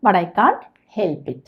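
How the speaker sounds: drawn-out, word by word; slow model sentence. Shown as a demonstration. Teacher showing learners the English sentence, very slow. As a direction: dictate it slowly to the class. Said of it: "teacher models sentence".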